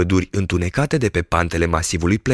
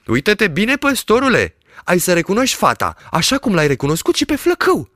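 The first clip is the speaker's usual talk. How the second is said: high, coaxing merchant voice; lightly voiced sly peddler; teasing peddler; 12 semitones higher, 3 dB louder than usual. A narrator's reading. Peddler urges the shepherd to look again, coaxing.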